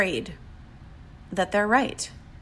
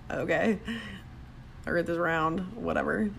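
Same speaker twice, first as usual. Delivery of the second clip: soft, shrugging brush-off; dismissive; 3 semitones lower, 8 dB quieter than usual. Retold or imitated. Thinking about a flat earther, soft dismissive shrug. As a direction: wave it away as not worth arguing. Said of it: "look at them and think".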